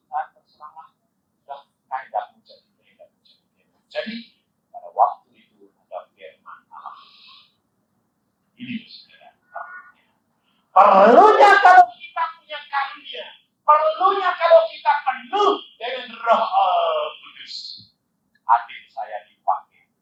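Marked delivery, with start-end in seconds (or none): 0.1-3.6 hushed, yielding retold prayer; resigned prayer. 10.7-17.9 high, forceful preaching voice; raised, insistent sermon delivery. Real, not acted.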